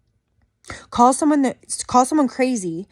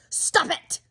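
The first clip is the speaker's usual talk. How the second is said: mock scolding; 5 semitones higher, 4 dB quieter than usual. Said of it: "the first woman who said, "Stop it".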